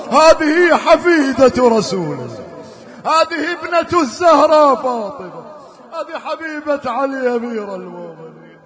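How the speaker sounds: loud impassioned mourning recitation; preaching at full force. Acted. Reciter rousing the mourners, loud and impassioned. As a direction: cry the story out to the mourners.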